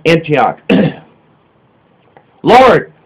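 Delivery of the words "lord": sudden high, loud cry of Lord; a startled shout of Lord. A narrator's reading. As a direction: cry out the name in alarm. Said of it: "shouts John".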